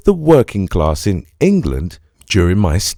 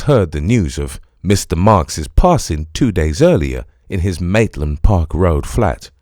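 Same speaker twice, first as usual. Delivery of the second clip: ordinary delivery, nothing marked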